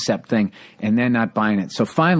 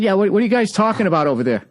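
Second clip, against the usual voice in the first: calling out again; 8 semitones higher, faster than usual. Retold or imitated. Repeats callout to hecklers.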